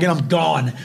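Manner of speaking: normal talking voice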